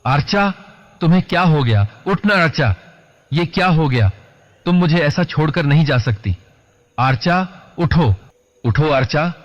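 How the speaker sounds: high-pitched anguished cry, held at moderate volume; desperate shouting. A narrator's reading. Grieving man begging dead Archa to get up, crying out. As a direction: cry out in disbelief, pleading. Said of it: "चिल्ला रहा था".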